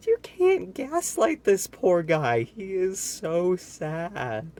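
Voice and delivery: high, slow pitying whine. Real, not acted. Reader pleads sadly for poor Mister Noisy.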